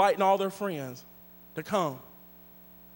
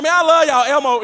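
higher, louder friendly call; hearty loud greeting; 6 semitones higher, 9 dB louder than usual. Retold or imitated. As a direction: holler it with affection. Greeting emo kids wrongly.

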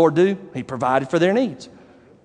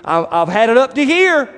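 fed-up parent; 6 semitones higher, 3 dB louder than usual. Retold or imitated. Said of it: "a parent before say to you".

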